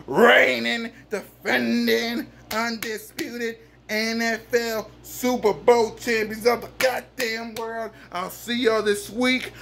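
ordinary talk

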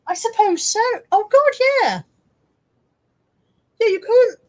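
high, loud laughing agreement; cracking up at the silly mug. Real, not acted. Laughing at the mug's ears, high and loud.

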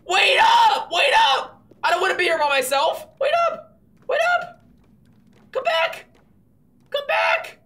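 very high, loud pleading cries; desperate calling out. Pretend. Player left alone calls after runaway, desperate shout. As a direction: holler after someone leaving you behind.

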